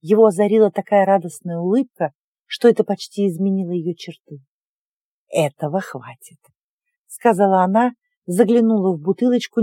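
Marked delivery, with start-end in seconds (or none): 5.3-6.4 low, soft relieved witch. Acted. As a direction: breathe it out with relief.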